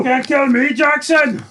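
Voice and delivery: very high hammed-up plea; mock panicked shout. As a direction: yell it like a comic victim begging. Joins in as panicking gunpoint victim.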